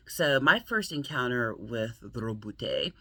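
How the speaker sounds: Robute with a slow, rolled R. Shown as a demonstration. Vlogger who can't help saying the name her way, rolled R. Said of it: "I can't help but say it that way".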